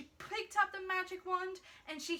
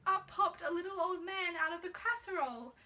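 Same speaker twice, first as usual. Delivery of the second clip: plain reading voice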